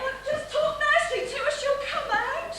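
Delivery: high, fast pleading through laughter. Acted. Wife pleading with husband at the door, high.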